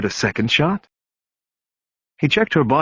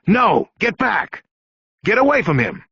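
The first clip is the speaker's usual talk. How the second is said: high, sharp shouted command; yelled order; 10 semitones higher than usual. Acted. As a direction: stop him with a shout. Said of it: "Bosch yelled".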